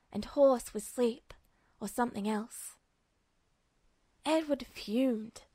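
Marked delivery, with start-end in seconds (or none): none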